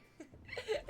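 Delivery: short high-pitched giggle; amused little giggle; laugh. Real, not acted. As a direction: burst into a quick high laugh. Laughing at viewer's competing gift.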